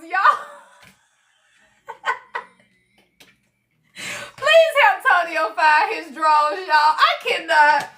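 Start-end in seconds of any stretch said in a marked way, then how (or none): none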